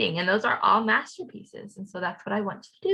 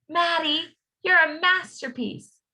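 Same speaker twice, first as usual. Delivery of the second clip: higher, slow, proud praise; proud dad; 8 semitones higher, 4 dB louder, slower than usual. A narrator's reading. Dad admiring his child's artwork, slow and proud. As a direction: savour the praise like a proud father.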